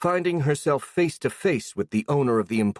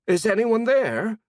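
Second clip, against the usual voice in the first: calling out; 12 semitones higher, 3 dB louder than usual. Acted. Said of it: "the voice asked".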